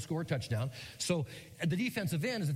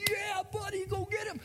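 high, shouted cheer; cheering dad. Retold or imitated. Dad cheering his son on, high excited shout. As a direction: yell like a proud dad in the stands. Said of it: "And Dad was".

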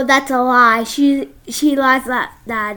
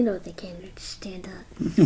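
low, hushed whisper; soft confidential aside; 7 semitones lower, 11 dB quieter than usual. Real, not acted. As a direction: whisper it to your co-host. Aside to co-host.

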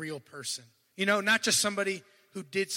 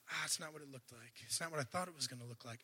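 much softer, quick and deflated; disappointed sigh; 5 semitones lower, 14 dB quieter, faster than usual. Acted. Preacher voicing a believer whose promise looked different, deflated and soft. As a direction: let it fall out in letdown.

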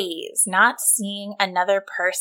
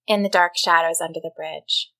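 ordinary delivery

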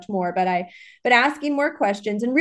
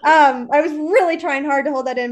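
high, loud laughing aside; 6 semitones higher, 6 dB louder than usual. Real, not acted. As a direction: laugh through the apology. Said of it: "excuse my sneeze".

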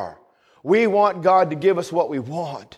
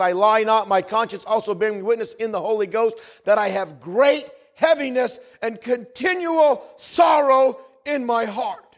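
lifted, measured scripture reading; 7 semitones higher than usual. Acted. Preacher reading Romans to the congregation, solemn. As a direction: read Paul's words with weight.

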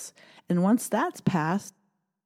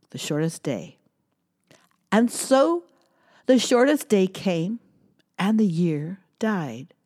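poem reading; 4 dB louder than usual. Acted. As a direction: read the poem with a storyteller's lift. Reads a poem aloud.

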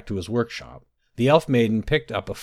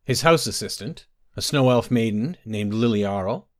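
plain reading voice

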